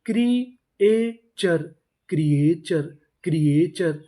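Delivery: "क्रिएचर" drawn out slowly, then said again twice crisply; teacher drilling "क्रिएचर" for a Hindi class; slow drilled word. Shown as a demonstration. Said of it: "तो meaning".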